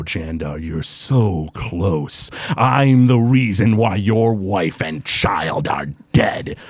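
low, slow, goading villain voice; villain taunting the hero to kill him, low and slow. Acted. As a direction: goad him, low and unhurried. GM voices Groon taunting Jandar.

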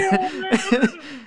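squeaky high laugh. Real, not acted.

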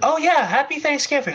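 excited. Real, not acted. Suddenly remembers the holiday.

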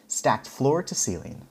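ordinary delivery

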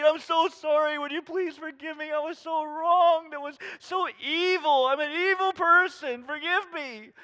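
high, loud, pleading apology; groveling plea. Pretend. Offender falling at the pastor's feet, begging loudly. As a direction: beg for forgiveness as if on your knees. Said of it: "if they would just fall to my feet".

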